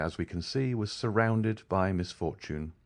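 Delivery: plain reading voice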